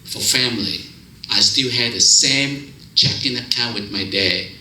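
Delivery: normal talking voice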